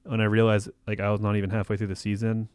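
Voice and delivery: normal talking voice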